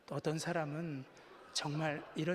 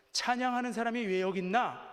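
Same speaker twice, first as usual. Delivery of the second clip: raised, higher-pitched retold exclamation; loud questioning call; 5 semitones higher, 6 dB louder than usual. Police officer finding the praise singer jailed, loud raised question. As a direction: call it out from outside a cell. Officer outside the cell, surprised.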